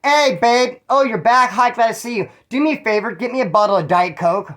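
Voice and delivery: lightly voiced sweet wife; wife greeting her husband, high and sweet. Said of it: "his old lady says".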